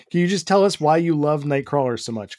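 ordinary delivery

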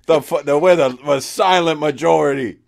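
loud, high smug gloating; gloating mock troll voice, loud and high; mock taunting reviewer. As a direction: ham up a smug troll firing back.

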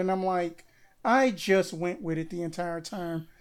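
ordinary talk

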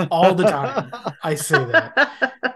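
talking through laughter; tickled, laughing as you talk. Real, not acted. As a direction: let real amusement break up the words. Cracking up over favorite line.

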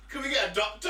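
high, loud mock plea; mock alarm. Co-host plays the alarmed patient, mock panic. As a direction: call out in hammed-up alarm.

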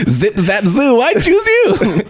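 squeaky, excited anime hero shout; high cartoon voice. Playful cartoon catchphrase.

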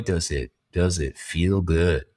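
slow, low, relishing murmur; savoring. Acted. Host savoring the rush of overwork, slow and relishing. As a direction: linger on "feel good" like a guilty pleasure.

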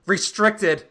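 loud, rising panic over each word; mock frantic Laura Dern. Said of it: "It's like".

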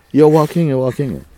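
loud, high, insistent repetition; emphatic correction. Real, not acted. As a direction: call it out loudly to correct them. Emphatically correcting the sitting-down misquote.

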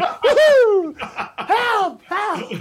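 very high, loud cartoon dog yelling for help, laughing; panicked pug. Pug stuck in the next foundation crying for help, very high and loud. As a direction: ham up the pug falling into another hole. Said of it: "it's like".

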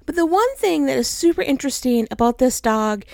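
ordinary talk, nothing marked

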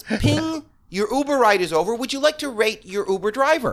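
imitating the phone's ride alert; mock app voice. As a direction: sing out the app notification.

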